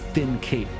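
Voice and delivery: ordinary talk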